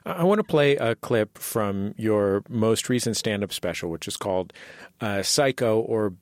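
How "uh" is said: ordinary delivery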